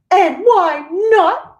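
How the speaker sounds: mother shrieking a slow demanding question; shrieked. A narrator's reading. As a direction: drag the question out at a screech. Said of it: "shrieked Missus Gloop".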